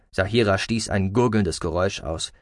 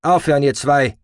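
loud, slightly raised order; commanding and controlled; firm command; 4 semitones higher, 7 dB louder than usual. Acted. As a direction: break up the quarrel firmly. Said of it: "befahl Roden mit gedämpfter, aber bestimmter Stimme".

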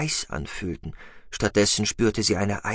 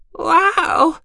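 loud, high amazed exclamation; excited high creature voice; 10 dB louder than usual. Acted. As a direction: gasp it out in wonder. The Ild exclaims.

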